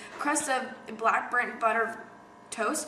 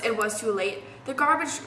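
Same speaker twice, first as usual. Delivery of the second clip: usual speaking voice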